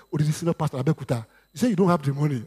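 ordinary talk, nothing marked

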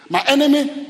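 loud, high declamation; defiant shout. Acted. Preacher declaring scripture against the enemy, defiant shout. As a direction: hurl it out at the foe.

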